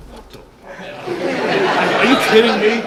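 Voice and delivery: loud, high, laughing disbelief; retelling a stunned reaction with a laugh; shocked disbelief. Retold or imitated. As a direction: blurt it out, stunned and half laughing. Retold shock at robbery news.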